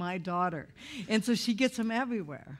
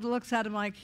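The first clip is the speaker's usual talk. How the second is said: normal talking voice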